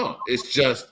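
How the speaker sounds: normal talking voice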